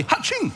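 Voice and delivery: high-pitched comic "haching" sneeze; mock sneeze. Preacher imitating the dreaded sneeze, high mock sneeze. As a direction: let out a sudden, high fake sneeze. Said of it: "satu kunci yang paling ditakuti".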